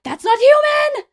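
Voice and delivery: high, loud, drawn-out scream; retold scream. A narrator's reading. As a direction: scream it in terror, dragging the words. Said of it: "He screams. It's like".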